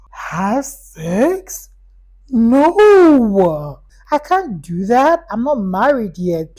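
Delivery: gasp, then a high, loud drawn-out "No"; gasping, horrified mock-innocent refusal; scandalized shock. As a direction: react like a scandalized church child.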